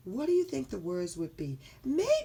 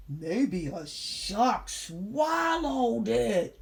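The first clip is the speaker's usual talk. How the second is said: loud, very drawn-out character voice; dramatic guess; 3 semitones higher, 5 dB louder, much slower than usual. Acted. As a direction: stretch each word out dramatically. Character imagines shark swallowing book.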